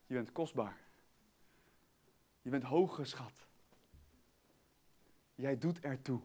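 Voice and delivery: low, soft, spaced-out assurance; tender reassurance. Real, not acted. Preacher telling listeners who they are, tender reassurance. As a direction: gently let each statement sink in.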